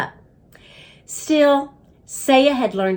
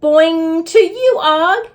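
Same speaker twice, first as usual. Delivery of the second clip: boing greeting; 8 semitones higher, 7 dB louder, slower than usual. A narrator's reading. Hamster answers frog.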